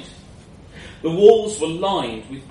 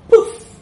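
sudden loud puff of sound; explosive breathy poof; magical poof; 15 dB louder than usual. A narrator's reading. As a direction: burst it out like a spell going off. Magic wish takes effect.

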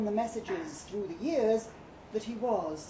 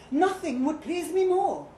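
higher, louder eager cry; exclaiming; 6 semitones higher, 7 dB louder than usual. A narrator's reading. Lovestruck statue exclaiming his eagerness to her. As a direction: burst out with eager delight. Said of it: "he exclaimed".

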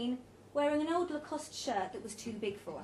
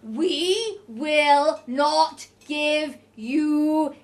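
very slow, loud, word by word; loud, high, painfully slow refusal; drawn-out syllables; 5 semitones higher, 16 dB louder, much slower than usual. A narrator's reading. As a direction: stretch every syllable as if for a foreigner. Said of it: "He drew the syllables out so slowly".

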